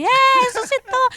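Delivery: high, slow, drawn-out cheer; imitating the crowd hailing the resurrection; mock triumphant cheer. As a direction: shout it like a movie climax. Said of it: "parece a final dos Vingadores".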